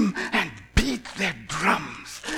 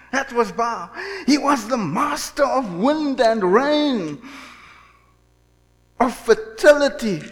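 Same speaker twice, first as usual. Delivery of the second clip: higher, louder, slow, each title hammered; rising preaching fervor; 6 semitones higher, 4 dB louder than usual. Real, not acted. Preacher to congregation, listing Baal's powers in a rising, slow, loud build. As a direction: build it up like a pulpit climax.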